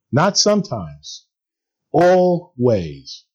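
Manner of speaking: usual speaking voice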